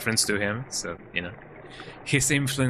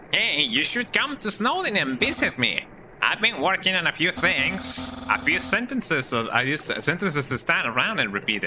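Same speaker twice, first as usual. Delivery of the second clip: higher, nasal skeleton voice; Papyrus voice; 5 semitones higher than usual. Acted. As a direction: play the cheerful skeleton, pitched up. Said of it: "different from the voice that I gave Papyrus".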